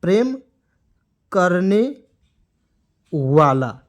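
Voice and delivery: "प्रेम करने वाला" slowly, a long pause after each word; word-by-word dictation. Shown as a demonstration. Teacher dictates meaning for students to write, word by word.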